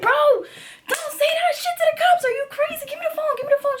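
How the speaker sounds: very high alarmed scolding; very high panicked telling-off. Retold or imitated. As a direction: stop her, alarmed. Scolding roommate on phone.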